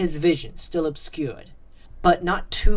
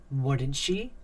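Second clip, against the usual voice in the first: plain reading voice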